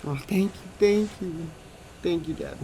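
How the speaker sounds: grateful daughter. Retold or imitated. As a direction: chirp your thanks like a relieved kid.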